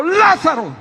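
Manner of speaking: high, loud call of a name; commanding shout. Retold or imitated. Jesus calling Lázaro out of the tomb, loud commanding shout. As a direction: call the dead man out with full force. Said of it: "que le dijo".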